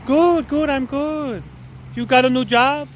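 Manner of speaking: high mock voice; manager impression. Manager asking about the new job, high mock voice. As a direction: imitate her reply, pitched well up. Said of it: "She goes".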